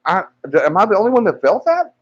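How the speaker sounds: high, frantic, pleading to be believed; frantic insistence. Retold or imitated. Telling the unbothered guys about the quake, frantic and high. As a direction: insist it was real, near panic. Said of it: "I said".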